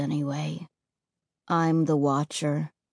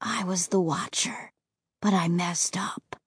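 unvoiced, soft whisper; 5 semitones lower, 8 dB quieter than usual. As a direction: confess in a whisper. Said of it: "he whispered".

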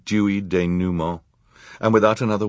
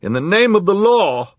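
commanding shout; 14 semitones higher, 8 dB louder than usual. A narrator's reading. Judge commands Olaf.

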